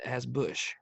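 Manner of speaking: lightly voiced presidential impression; quick, light impersonation of the former president; George W. Bush. Podcaster offering to finish the show, light Bush impression. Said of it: "That's a pretty good Bush".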